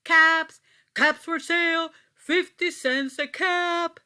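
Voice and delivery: loud, high street-seller call; calling out wares. Acted. Peddler calling out to town to sell caps, loud and high. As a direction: sing it out to the whole town. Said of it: "walked back to town calling".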